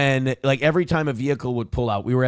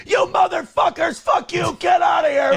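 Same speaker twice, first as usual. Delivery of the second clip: much higher, screaming curses at the vehicle; sudden raging scream, much higher and louder; furious shout; 5 dB louder than usual. Retold or imitated. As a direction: scream at the truck like she snapped. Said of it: "she jumps out in the street".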